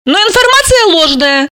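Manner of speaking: high-pitched character, calmly correcting; Alisa. Acted. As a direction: play Alisa setting the facts straight.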